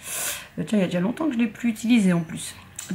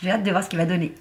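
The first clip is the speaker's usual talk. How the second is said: usual speaking voice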